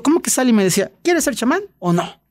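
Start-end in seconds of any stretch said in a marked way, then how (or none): 0.0-0.5 high, disbelieving cry; incredulous protest. Retold or imitated. Retold protest repeated. 1.0-2.2 high, teasing challenge; mocking shaman. Shaman voiced challenging him.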